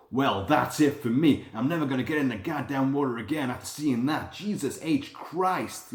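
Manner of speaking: slow transatlantic drawl, mocking the uploader; mocking transatlantic narrator drawl. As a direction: imitate the bozo uploader's transatlantic drawl, slowly. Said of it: "with that transatlantic drawl".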